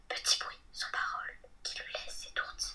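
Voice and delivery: soft voiceless whisper; whispered verse. Acted. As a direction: whisper it, barely a sound. Poème, petit bruit sans parole. (vers chuchotés, tout bas)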